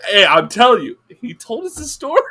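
loud, high-pitched defence; insistent protest. Real, not acted. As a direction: protest loudly that it's what you were told. Insists against teasing.

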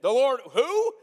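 high, loud puzzled who; confused owner quizzing the thief; baffled question. Pretend. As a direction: demand to know who the Lord is. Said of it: "And you're like".

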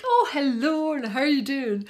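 high, loud, gushing mock hello; imitated chatty greeting. Chatty festival-goer touching her arm to say hello, imitated effusively. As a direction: imitate an over-friendly stranger greeting someone.

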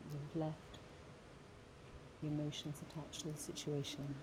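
slow, gentle meditation voice with long pauses; guided meditation. Acted. Guiding listeners through a forgiveness meditation, slow and gentle. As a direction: ease the listener through the breath hold softly.

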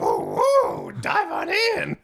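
croaky frog voice, wild pitch swings; like a worse Kermit the Frog. Retold or imitated. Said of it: "sound like some sort of Kermit the Frog thing".

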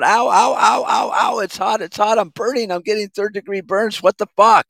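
high, whining mock cries of pain; mock pained yelps. Ranter mocks people burning their hand, high whining yelps. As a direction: yelp like a fool with a hand in the flame. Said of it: "holding your hand over a flame and going".

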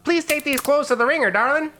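very high gnome voice; gnome innkeeper. Acted. Gnome hands clothes to courtesan, in a high squeaky voice. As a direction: ask sweetly in a high, squeaky voice.

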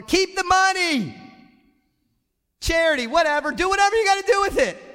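shouting keep the money; booming donor. Acted. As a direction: brush off the change with a big shout. Acting donor waving off change.

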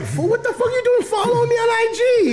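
high, hammed-up outraged yell; play-scold the kid for following you; mock outrage. Teacher jokingly scolds student for following him, hammed-up high yell. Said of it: "I'm like".

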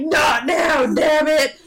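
put-on loud high-pitched snap at the kid; angry dad shout. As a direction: bark it like a frazzled father. Dad snapping back at kid.

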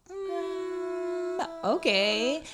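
hesitant acceptance. Retold or imitated. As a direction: draw out a doubtful hum before giving in. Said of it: "her family at the time was like".

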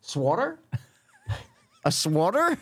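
SWATTER echoed high as swaw-ter, rising question; high, laughing echo of SWATTER; mocking echo. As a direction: mimic SWATTER with an aw vowel, incredulous. Mocking friend's pronunciation.

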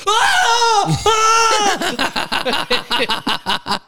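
loud high-pitched yell of pain; comic full-voice "Ah!"; re-enacted scream. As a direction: scream as if the knees are giving way. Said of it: "I scra-, I'm like".

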